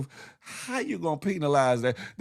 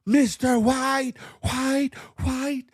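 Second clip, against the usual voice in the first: teacher calling out; 6 semitones higher, 4 dB louder, slower than usual. Retold or imitated. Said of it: "when she called on me".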